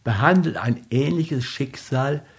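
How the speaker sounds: radio announcer. Acted. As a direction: read the outro like a radio host.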